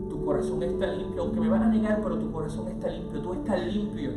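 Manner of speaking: ordinary delivery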